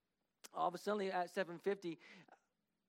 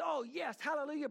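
delighted; 9 semitones higher, 4 dB louder than usual. Retold or imitated. Retold pastor's delighted reply.

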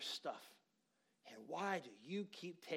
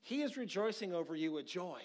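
loud, higher, driving preaching; preaching at full force; 5 semitones higher, 7 dB louder than usual. Acted. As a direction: build it up and push every line out. Preaching resumes after aside.

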